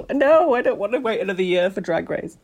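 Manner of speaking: high-pitched pretend protest; mock protesting contestant. Acting out an injured contestant refusing.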